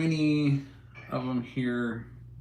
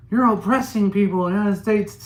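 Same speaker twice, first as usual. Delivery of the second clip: high, loud mocking voice of the accuser; mimicking the people who always make that argument; 7 semitones higher, 5 dB louder than usual. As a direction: parrot the complaint in a whiny put-on voice.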